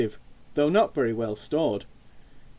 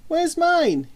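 put-on high, indignant boy asking; a kid wanting his pizza; young boy voice; 5 dB louder than usual. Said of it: "he asked".

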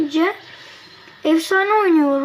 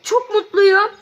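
higher, louder "çok mutluyum" after the win; happy exclamation; 6 semitones higher, 5 dB louder, slower than usual. Real, not acted. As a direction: beam out your joy at winning. After winning the match. (maçı kazandıktan sonra daha yüksek, coşkulu bir sevinç)

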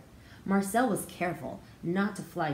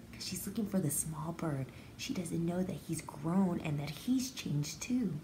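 whispered aside; 2 semitones lower, 6 dB quieter than usual. Real, not acted. Teacher whispering a secret to children. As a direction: let them in on a secret, whispering.

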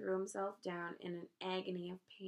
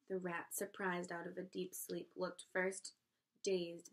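usual speaking voice

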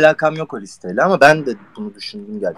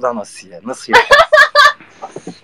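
higher-pitched, playful lovesick huff; sulky mock tantrum; 4 dB louder, much slower than usual. Actor imagines Doruk sulking at Asiye, mock-aggrieved. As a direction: act out a jealous boyfriend's sulk. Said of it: "böyle tribe giriyor olabilir".

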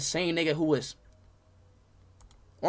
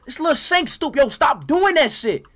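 high, loud, furious; angry yelling; 10 semitones higher, 8 dB louder than usual. Real, not acted. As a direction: yell at a friend in anger. Friend spamming annoying sound.

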